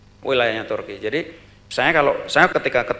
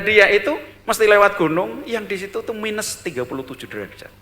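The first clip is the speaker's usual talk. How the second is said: ordinary delivery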